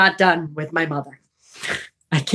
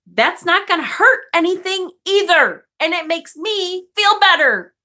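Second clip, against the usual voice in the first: defiant retort; 10 semitones higher than usual. Retold or imitated. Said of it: "And I'm like".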